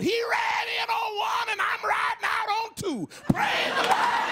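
very high shouting with a cry in it; triumphant shout of praise; shouted jubilant praise. Retold or imitated. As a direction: shout the victory. Joyful shouted punchline.

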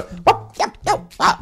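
hoge korte Mario-uitroepjes; Mario grunts. Shown as a demonstration. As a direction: springgeluidjes als Mario.

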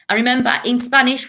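ordinary talk

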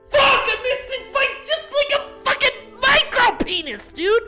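ordinary delivery

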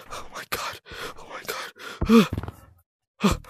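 very soft, voiceless gasp; hushed, stunned disbelief; frightened whisper. Real, not acted. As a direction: whisper in dread to yourself. Hiding alone, friend gone.

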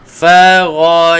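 ra said from the throat, not fa; demonstrating the throat letter ra; throaty gh sound. Shown as a demonstration. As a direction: push ra back to the throat. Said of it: "huruf ra dekat halkum".

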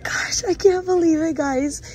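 real disbelieving shout; excited shout. Real, not acted. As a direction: cry out in disbelief. Shopper overwhelmed by find.

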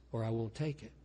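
ordinary talk

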